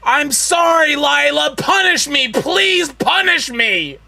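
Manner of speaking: loud, high pleading yell; begging shout. Real, not acted. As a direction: beg loudly for punishment.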